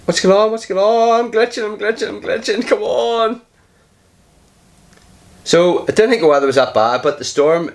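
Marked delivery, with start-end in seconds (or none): 0.0-3.4 high squeaky alarm at the glitch; squeaky panic. Real, not acted. Gamer yelps at his glitching game, high and panicky. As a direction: squeal it out, rattled.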